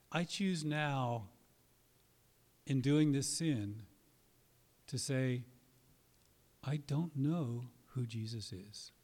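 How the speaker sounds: slow, weighty words, each phrase set apart; slow deliberate declaration. Shown as a demonstration. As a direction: declare it slowly, as a solemn confession. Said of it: "try doing this".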